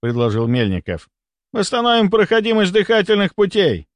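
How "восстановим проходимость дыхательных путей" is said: high-pitched insistent exclamation; urgent proposal. A narrator's reading. A doctor proposes clearing the patient's airway, urgently. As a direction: push the plan with raised pitch.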